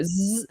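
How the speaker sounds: imitated buzz of prison doors; buzzing door noise. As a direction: buzz like a heavy automatic door.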